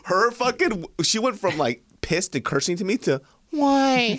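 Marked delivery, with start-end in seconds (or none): 3.5-4.2 very high, loud, innocent why; anime-eyed innocent why. Retold or imitated. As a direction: squeak it like wide anime eyes. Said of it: "Fucking anime eyes".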